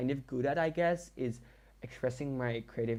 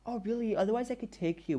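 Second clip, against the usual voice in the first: higher, sugary mock-friendly teacher voice; fake nice; 10 semitones higher than usual. Teacher offers the student a ride, mockingly fake nice. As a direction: pour on false sweetness with a pitched-up tone. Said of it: "so fake nice to me".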